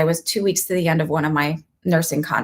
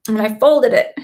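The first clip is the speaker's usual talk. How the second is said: normal talking voice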